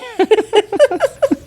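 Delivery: high breathy laugh. Real, not acted. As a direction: let out a laugh. Laughing at own joke.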